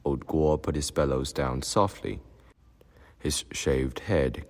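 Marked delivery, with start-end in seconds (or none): none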